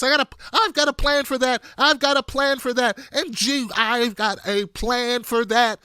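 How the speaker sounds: mock over-emphasis on every word; Elizabeth Warren. Host mocking a candidate's catchphrase, over-emphasized. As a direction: mimic a debater stressing each word, slowing at the end. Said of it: "Every word I am going to emphasize".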